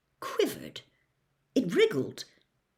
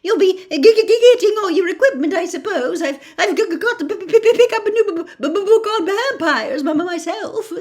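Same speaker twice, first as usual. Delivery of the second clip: higher and louder, stuttering with a shaky laugh; a jittery teacher tripping on every word; nervous stammer; 10 semitones higher, 10 dB louder, faster than usual. Acted.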